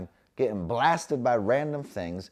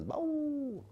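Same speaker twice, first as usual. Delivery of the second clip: much higher, squeaky voice for the dog. Pretend. Voicing the dog getting its neck scratched, high and squeaky. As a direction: speak as the dog, very high and small. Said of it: "what she really says is".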